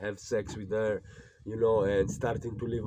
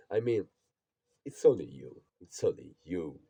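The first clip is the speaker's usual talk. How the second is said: ordinary delivery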